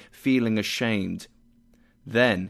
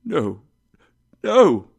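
anguished no; 4 semitones higher, 8 dB louder than usual. Acted. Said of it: "said Scrooge".

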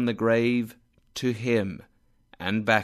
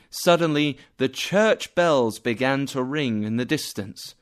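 ordinary delivery

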